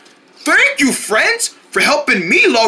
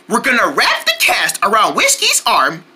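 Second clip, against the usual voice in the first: usual speaking voice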